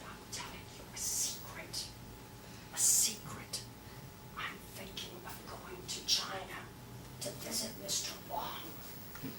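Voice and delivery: put-on whispered like a secret to children; conspiratorial whisper to the kids. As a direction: lean in and whisper it.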